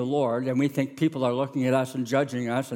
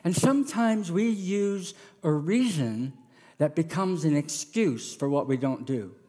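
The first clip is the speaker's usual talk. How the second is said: usual speaking voice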